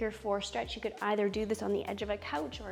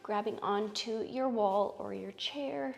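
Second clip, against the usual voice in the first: soothing yoga teacher; 5 dB quieter, slower than usual. Acted. Guides yoga poses slowly.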